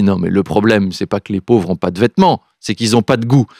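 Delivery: a hint of a snide MP; snooty politician. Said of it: "un député L R qui m'a dit".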